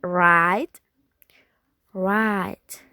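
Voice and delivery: "right" with a wide ah-ee glide; American right. Shown as a demonstration. Teacher contrasting accents, American right. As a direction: say "right" the American way, clear ai vowel. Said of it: "in America is right".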